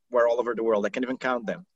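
ordinary delivery

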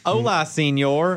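put-on slow, mangled Spanish with a Southern accent; Southern politician attempting Spanish, drawn out; George W. Bush. Comic riffing on distorted languages, Southern-accented Spanish. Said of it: "we're just doing George W. Bush".

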